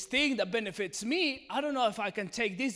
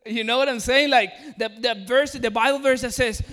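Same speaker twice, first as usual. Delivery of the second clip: loud, raised preaching; 5 semitones higher, 12 dB louder than usual. Real, not acted. Preacher rousing the congregation, loud emphatic delivery. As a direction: drive it home to the congregation.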